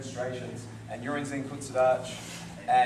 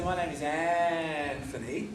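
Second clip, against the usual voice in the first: Anthony with the An stretched far out; slow first syllable; much slower than usual. Shown as a demonstration. Teacher showing how they don't say it, first syllable dragged. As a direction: drag the An of Anthony, then finish quickly. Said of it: "Just like I don't say".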